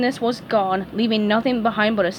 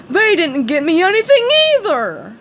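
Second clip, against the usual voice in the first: high, loud furious roar; bellow; 8 semitones higher, 7 dB louder than usual. A narrator's reading. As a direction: bellow it out in a rage. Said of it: "He stomped his foot and bellowed".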